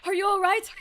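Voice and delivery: high, loud, urgent question; alarmed concern. Acted. Checking on Susato after the jolt, alarmed call.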